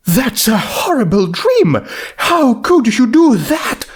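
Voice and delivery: distressed cry. Acted. Rarity upset at Autumn Sky.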